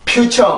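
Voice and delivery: "future" as pyu-cheo without r colour; wrong Koreanized pronunciation. Shown as a demonstration. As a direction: end "future" on an open "cheo". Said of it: "이렇게 발음이 되는 게 아니고".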